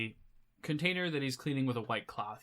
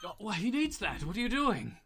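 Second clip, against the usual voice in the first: higher-pitched character voice with a laugh in it; protesting tavern patron; 8 semitones higher than usual. Acted. Patron protests as she picks up his coin, high-voiced. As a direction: object in a high, amused voice.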